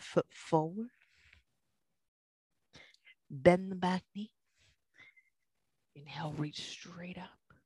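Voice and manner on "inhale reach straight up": hushed whispered cue; whispering. Real, not acted. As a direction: whisper it to the class. Yoga cue whispered mid-flow.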